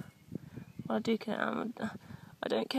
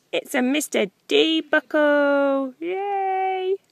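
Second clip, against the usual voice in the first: cheerful celebrating; 6 semitones higher, 10 dB louder than usual. Real, not acted. Cheering the dog's find.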